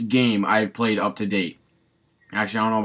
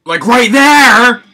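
slow, high, loud yell; raging gamer; 11 semitones higher, 12 dB louder, much slower than usual. Real, not acted. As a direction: drag it out in a furious yell. Repeats same words rising rage.

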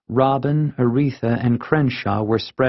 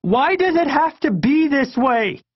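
put-on very high-pitched anguished kid wail; tearful outcry. A narrator's reading. Kid gasping out a question to parents, distraught. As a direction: cry it out at the top of the range. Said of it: "I was crying hard".

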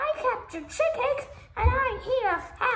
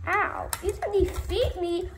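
ordinary talk

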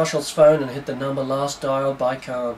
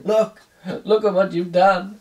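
hammed-up dying man; 4 semitones higher than usual.

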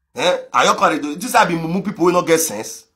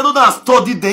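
higher and louder second telling; emphatic retold warning; louder repeat; 7 semitones higher, 7 dB louder than usual. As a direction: push it up with insistence.

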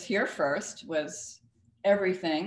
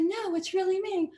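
much higher, airy elegant voice; breathy high society lady; Jackie Kennedy; 11 semitones higher than usual. Retold or imitated. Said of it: "And she said".

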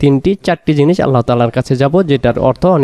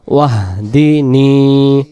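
slow recitation; much slower than usual. Shown as a demonstration. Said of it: "teacher recites word slowly".

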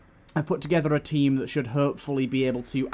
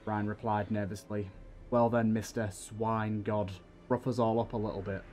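steady, dramatic storytelling read; story narration; 4 dB quieter than usual. A narrator's reading. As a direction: draw the listener into the battle. Reads second diary aloud.

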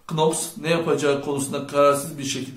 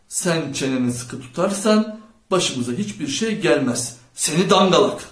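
plain reading voice

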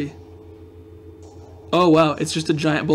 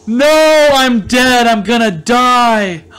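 high, loud cry easing downward; dismayed wail; 4 semitones higher, 5 dB louder, much slower than usual. Real, not acted. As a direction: wail in drawn-out defeat. Player losing the game.

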